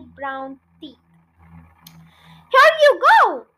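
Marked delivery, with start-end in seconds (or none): 2.5-3.5 high, loud call; cried out. A narrator's reading. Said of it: "George cried".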